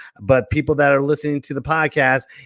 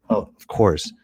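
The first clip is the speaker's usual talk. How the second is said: ordinary delivery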